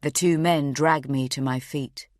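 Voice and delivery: ordinary delivery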